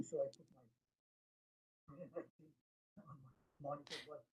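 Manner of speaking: weak, faraway line; fading in and out. Real, not acted. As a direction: voice dropping off mic. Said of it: "Your sound quality is, is not good".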